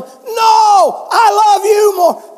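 high, loud playful yell, slow; yelling child. Retold or imitated. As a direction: shout it out to win the game. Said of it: "And he'd be yelling".

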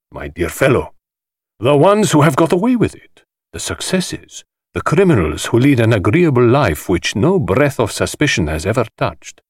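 high, showy voice of a flamboyant collector; theatrical host. Acted. The host lecturing the detective, grand and high-pitched. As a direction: relish every word like a showman.

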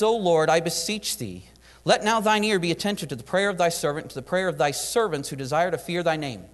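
scripture reading. Acted. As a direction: read the prayer out with weight. Reads Nehemiah's prayer from scripture.

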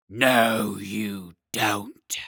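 loud no, then a spluttering hiss; spluttering dragon. Acted. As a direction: splutter it out like a damp squib. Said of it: "in a spluttering voice like a damp squib".

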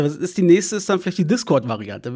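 ordinary delivery

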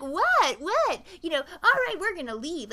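lightly voiced offended brother; indignant surprise. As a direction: play the hurt, baffled brother. Brothers indignant at thrown cans.

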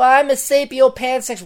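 high, mocking put-on voice; mocking quote. Mocking Lacey Green's claim in a high voice. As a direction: sneer it in a silly high voice.

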